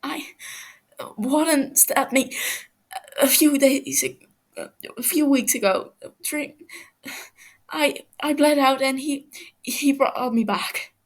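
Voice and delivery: pained confession. Acted. Captive Fofo confesses under pressure, high and pained. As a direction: spill it out in pain.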